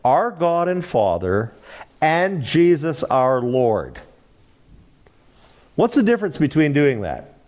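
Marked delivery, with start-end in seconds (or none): 0.1-4.0 slow deliberate reading. Shown as a demonstration. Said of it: "And then it says".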